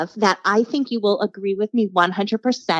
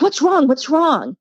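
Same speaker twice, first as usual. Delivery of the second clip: worried dad; 6 semitones higher, 3 dB louder, slower than usual. Retold or imitated. Dad finds crying child.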